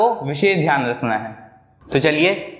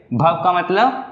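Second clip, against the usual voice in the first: ordinary talk, nothing marked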